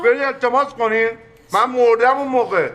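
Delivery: over my dead body, said loud; a man shouting down his sister's idea; loud refusal. Acted. Refuses to let her beg.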